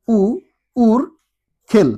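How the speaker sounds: উর খেল built up slowly, g said as kh; slow syllabified demo. Shown as a demonstration. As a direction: sound out উর খেল piece by piece, kh not j. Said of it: "উর জেল কিন্তু হচ্ছে না".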